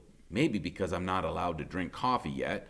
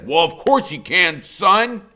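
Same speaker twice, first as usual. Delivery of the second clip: delighted grandfather; 8 semitones higher, 11 dB louder than usual. A narrator's reading. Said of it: "he says".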